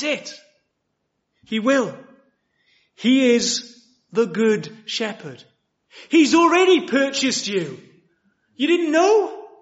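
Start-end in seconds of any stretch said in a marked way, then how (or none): 6.1-9.5 fervent preaching. Real, not acted.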